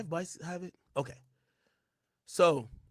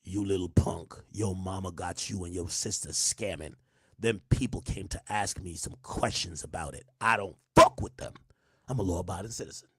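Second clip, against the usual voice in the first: quoting [name]; 7 semitones lower than usual. Retold or imitated. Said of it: "'Cause fifty says".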